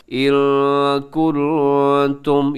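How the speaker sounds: slow इन कुंतुम with each n held as a hum; ikhfa. Shown as a demonstration. Teacher demonstrating noon sakin for students, ikhfa in इन कुंतुम. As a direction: hold the n of "इन" and of "कुंतुम" in the nose. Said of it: "इख्फा हो रहा है".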